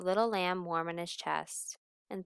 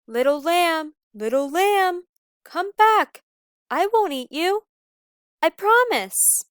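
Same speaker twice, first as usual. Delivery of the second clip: loud, high calling; crying out; 11 semitones higher, 12 dB louder than usual. Acted. Wolf searching the woods, loud high calls. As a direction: call into the dark woods, pleading.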